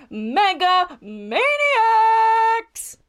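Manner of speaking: very high, hugely stretched team name; soaring, drawn-out arena introduction; announcer yell. Acted. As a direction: hold the last word like a ring announcer. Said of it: "the M C yells once again".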